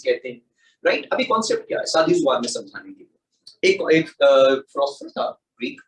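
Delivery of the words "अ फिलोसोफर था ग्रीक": ordinary delivery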